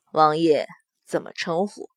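low, composed male voice; cool young lord. A narrator's reading. Long Chen asks the prince his name, low and composed. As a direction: voice a man politely sizing up a stranger.

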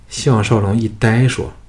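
usual speaking voice

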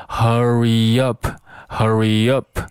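"hurry up" slowly joined as "hurry-yup"; linked words. Shown as a demonstration. Teacher demonstrates linking to learners, slow joined "hurry up". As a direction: glide from "hurry" straight into "up", drawn out. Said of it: "也要连在一起".

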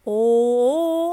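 "哦" sung-like, round and loud; bel canto o. Shown as a demonstration. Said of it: "就像我们练美声的时候". (像美声一样的"哦")

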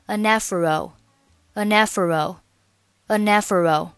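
"an afro" slowly, n carried onto "afro"; linked pronunciation. Shown as a demonstration. Teacher demonstrating linking, "an afro" slowly run together. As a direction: join "an afro" like "a-nafro". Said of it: "是可以连读的".

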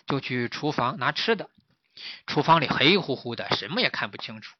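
ordinary delivery

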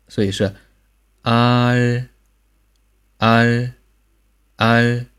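啊 held, tongue tip touching the palate; 啊 ending with the tongue tip on the palate; final r coda. Shown as a demonstration. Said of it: "舌尖抵到你的这个上颚". (舌尖抵上颚收音的啊)

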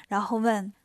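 ordinary delivery, nothing marked

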